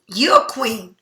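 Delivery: ordinary talk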